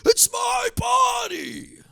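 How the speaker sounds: high, slow shrieking yell of "it's my body"; mock scream. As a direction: screech it like a hammed-up tantrum. Mock excuse to the boss.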